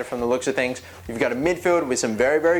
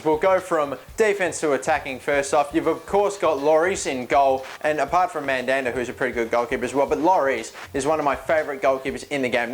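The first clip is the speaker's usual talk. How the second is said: ordinary talk, nothing marked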